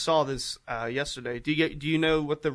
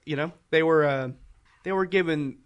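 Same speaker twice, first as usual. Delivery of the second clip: ordinary talk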